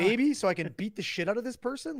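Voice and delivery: loud, high-pitched mock call for help; retold exasperated appeal. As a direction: re-enact calling out to bystanders in exasperation. Retold exasperated appeal during quarrel.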